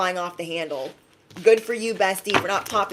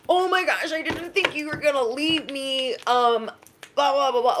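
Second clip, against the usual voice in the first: much higher, whiny mock sob voice; mocking crocodile tears; 6 semitones higher than usual. Mocking the ex begging not to be left, higher whiny. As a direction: parody their fake pleading. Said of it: "Insert ugly crying face".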